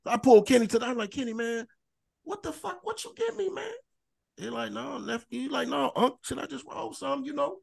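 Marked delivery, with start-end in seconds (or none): 1.1-3.6 very high, hushed bewildered plea; bewildered complaint. Retold or imitated. Stoned man confronting his friend Kenny, bewildered complaint. 4.7-7.6 high, soft imitation of an explanation; retold excuse. Imitating Kenny explaining what he rolled, soft retold excuse.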